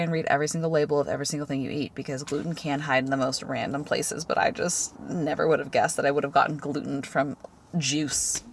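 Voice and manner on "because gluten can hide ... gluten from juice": ordinary talk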